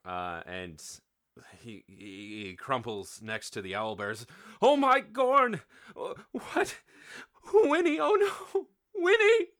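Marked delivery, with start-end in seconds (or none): 4.6-9.5 very high, loud grief-stricken wail; high-pitched crying out, loud and distraught; anguished sobbing. A narrator's reading. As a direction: wail in hammed-up horror and grief. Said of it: "He begins to sob uncontrollably".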